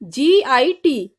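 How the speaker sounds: each letter spelled slowly and apart. Shown as a demonstration. Teacher spelling a word slowly for students.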